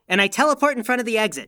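usual speaking voice

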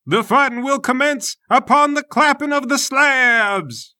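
high, booming ceremonial call; judge's announcement. Acted. Judge opening the fight before the crowd, grand announcement. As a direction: announce it to a whole arena. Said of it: "A judge announces".

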